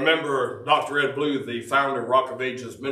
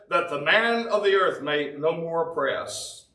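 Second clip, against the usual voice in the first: scripture reading; 4 semitones higher than usual. Acted. Reading psalm aloud.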